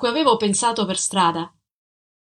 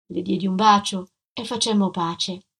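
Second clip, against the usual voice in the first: plain reading voice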